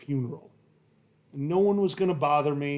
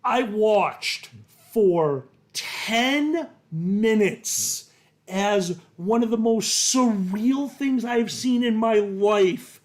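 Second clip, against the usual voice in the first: slow, higher and louder with wonder; excited; 5 semitones higher, 4 dB louder, slower than usual. Real, not acted. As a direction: build up the amazement. Recalling surreal delight, rising.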